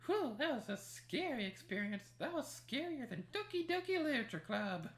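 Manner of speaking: high squeaky sped-up voice; chipmunk-like squeaky talk; helium voice. Pretend. Sped-up commentary.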